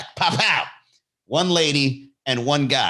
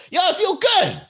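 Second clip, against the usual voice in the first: high-pitched triumphant cry; acted-out feel-good exclamation; elated. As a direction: proclaim it, pitched way up and thrilled. Acting out elation after good deed.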